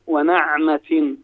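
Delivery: ونعمة pronounced "wa-na'matin", with "a" after the nun; slow ونعمة with an open "na"; fatha on the nun. Shown as a demonstration. Guest quotes the verse as proof, saying ونعمة slowly as "wa-na'matin". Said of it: "بفتح ال-، النون".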